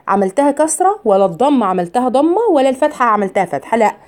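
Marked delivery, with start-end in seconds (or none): none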